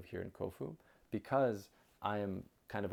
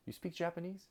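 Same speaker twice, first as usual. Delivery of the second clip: put-on curious old man; 9 semitones higher, 5 dB quieter than usual. Old man questions him in bath.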